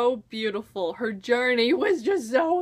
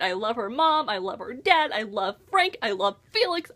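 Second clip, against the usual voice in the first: normal talking voice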